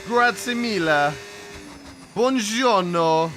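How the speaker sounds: put-on slow, drawn-out Italian accent. Streamer greeting chat in mock Italian accent. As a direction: savour each word in a mock Italian accent. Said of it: "We're gonna put on the accent today".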